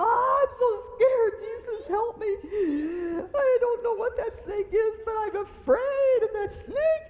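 high, loud, frightened crying voice; a high cry of fear, begging Jesus for help; terrified thoughts on seeing the snake, cried out high; scared prayer. Retold or imitated. Retelling her fear of the snake.

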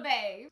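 Fave settled low as a short "vay"; French accent try. Shown as a demonstration. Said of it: "how you would say it with the accent".

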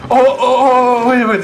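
high, loud startled ohs; urgent, high, easing slightly; sudden alarm. Real, not acted. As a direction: cry out as if caught off guard. Sudden alarm while playing.

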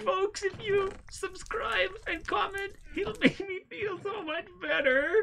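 very high, slow whimpering; mock crying plea. Player asking viewers to subscribe, mock tearful whine. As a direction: beg through hammed-up tears.

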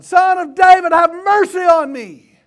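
very high, loud plea, drawn out at the end; full-force acted cry of a blind beggar; desperate shout. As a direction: shout for mercy over a crowd. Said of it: "He shouted".

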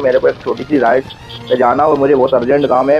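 ordinary talk

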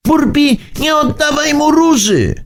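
high pleading call. A narrator's reading. The rainbow calls to Burbi, high and pleading. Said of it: "zawołała tęcza".

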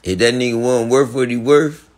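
usual speaking voice

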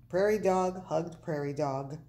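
ordinary delivery, nothing marked

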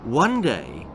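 ordinary delivery, nothing marked

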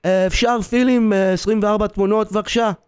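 imitated customer rattling off a request; Hebrew-speaking customer. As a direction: put on a fast, high customer's voice in Hebrew. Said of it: "this voice behind me in Hebrew".

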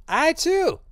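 very high cry at a contained volume; strained high mock shout; shouting in character. A narrator's reading. Old man calling out to the gallery, high-pitched cry. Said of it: "Drioli was shouting".

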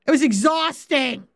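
ordinary talk, nothing marked

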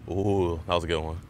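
ordinary talk

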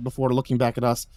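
plain reading voice

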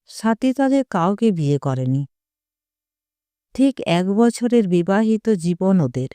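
ordinary delivery, nothing marked

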